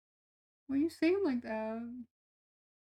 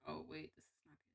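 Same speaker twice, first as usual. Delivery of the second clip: much softer, low under-the-breath realization; quiet dawning realization; 6 semitones lower, 19 dB quieter than usual. Retold or imitated. Listener realizing the nice words are an insult, quietly dismayed. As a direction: hush it as the insult sinks in. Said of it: "you're like".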